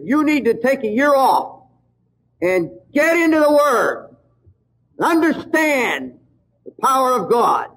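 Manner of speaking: foreign messenger voice. Retold or imitated. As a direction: deliver it like a solemn foreign prophet. Foreign stranger's prophetic message.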